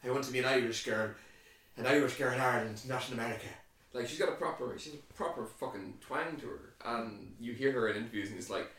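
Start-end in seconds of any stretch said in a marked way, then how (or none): 0.0-3.5 put-on Irish film accent. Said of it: "the, the Brooklyn".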